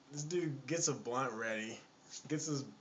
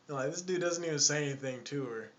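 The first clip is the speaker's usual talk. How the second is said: plain reading voice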